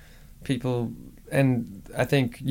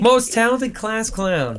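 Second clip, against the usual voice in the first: mock announcement; 9 semitones higher, 4 dB louder, slower than usual.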